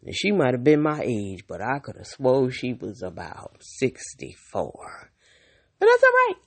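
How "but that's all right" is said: much higher, louder sing-song brush-off; high sarcastic shrug. Real, not acted. Shrugging off the nurse, high sarcastic brush-off. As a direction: toss it away in a high mocking lilt.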